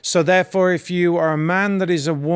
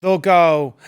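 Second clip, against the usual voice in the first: ordinary talk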